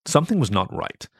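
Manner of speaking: plain reading voice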